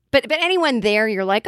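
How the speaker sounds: ordinary delivery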